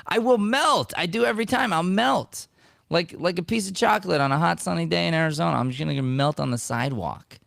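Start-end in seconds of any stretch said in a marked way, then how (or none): 0.1-4.4 gushing. Real, not acted. Excited confession, much higher.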